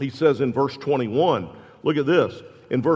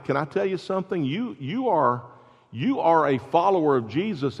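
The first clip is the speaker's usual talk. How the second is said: usual speaking voice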